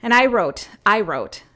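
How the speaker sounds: ordinary talk, nothing marked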